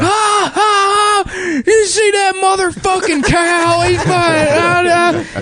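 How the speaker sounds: very high, wailing mock cackle with words; hammed-up impression of a drunk moviegoer cracking up; imitating hysterical laughter.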